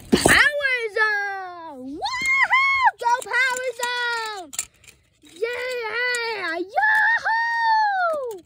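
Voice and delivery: long, stretched crowd-style whoops; drawn-out cheering. Pretend. Child cheers toy racer Power Zone, drawn-out whooping. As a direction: cheer it on, holding every word.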